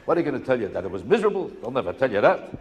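normal talking voice

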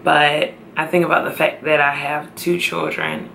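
ordinary delivery

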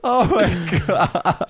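laughing. Real, not acted. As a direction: exclaim through helpless high laughter. Cracking up at the word.

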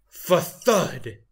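loud spoken crash effect; imitated thud. A narrator's reading. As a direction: punch it out like a sudden bump. Sound of horse hitting bump.

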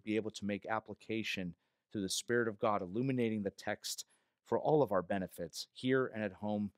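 low, soft pastoral prayer; hushed, reverent praying voice. Real, not acted. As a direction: lead the congregation quietly in prayer. Leads congregation in prayer.

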